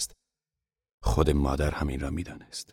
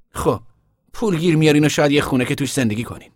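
put-on higher, louder young man pleading; young son urging his father, louder and higher; Tom's earnest plea; 10 semitones higher, 7 dB louder than usual. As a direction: press the family to agree, urgently. Tom pleads with family.

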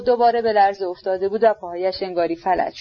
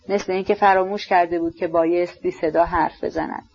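ordinary delivery, nothing marked

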